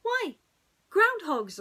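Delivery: Pooh voice. A narrator's reading. Pooh answering brightly.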